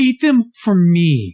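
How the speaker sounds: slow mock-begging, each word drawn long; drawn-out plea. Urging a friend to eat the Cheetos for him, slow and drawn out. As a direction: stretch it out slowly, pleading.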